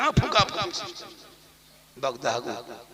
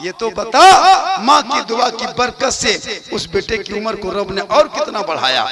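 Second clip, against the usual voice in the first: full-force preaching; 4 semitones higher, 14 dB louder than usual. Acted. Preacher telling the mother's-prayer miracle to the congregation, full-force preaching. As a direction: belt the holy story out to the crowd.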